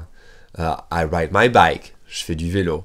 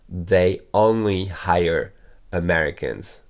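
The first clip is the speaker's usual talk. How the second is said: slow model sentence; slower than usual. Shown as a demonstration. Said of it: "teacher repeats slowly".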